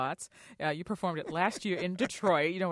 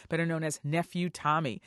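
ordinary talk

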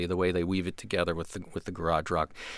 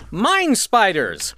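slow, big announcer voice; dramatic announcer; 4 semitones higher, 4 dB louder, much slower than usual. Acted. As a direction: boom out the band name slowly.

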